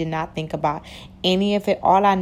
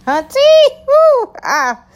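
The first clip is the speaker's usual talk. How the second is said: excited squeal; 10 dB louder, much slower than usual. Real, not acted. Hyping up listeners over the topic.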